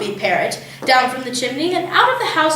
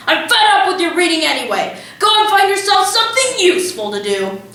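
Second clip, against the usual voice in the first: raised, scolding voice, pitch up; scolding father; 6 semitones higher than usual. A narrator's reading. Father scolding Matilda while ripping her book, fed up.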